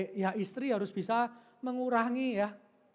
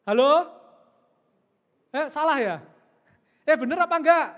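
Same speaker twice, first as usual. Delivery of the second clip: much louder prodding call; 4 semitones higher, 10 dB louder than usual. Real, not acted. Preacher calling out to the silent wives, loud prodding. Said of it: "calling out to silent congregation".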